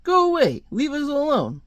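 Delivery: very high-pitched acted cry; hammed-up fending off of a crowd; panicked plea. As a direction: jump way up high in alarm. Reedy surrounded by begging crowd.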